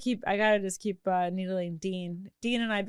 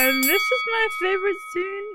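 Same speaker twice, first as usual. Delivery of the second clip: high, louder tipsy fan voice; drunk gushing; 11 semitones higher, 4 dB louder than usual. Retold or imitated. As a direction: gush like a drunk superfan. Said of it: "Yeah, I like".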